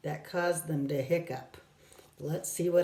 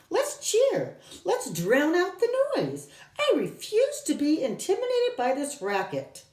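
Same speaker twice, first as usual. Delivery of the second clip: rallying professor; 11 semitones higher than usual. Acted. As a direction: refuse to be cowed, rally everyone. Professor rallies the children.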